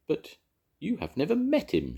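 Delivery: high, angry outburst; shouted. A narrator's reading. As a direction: snap back at her. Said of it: "shouted Jones".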